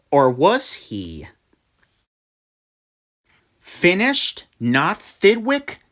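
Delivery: plain reading voice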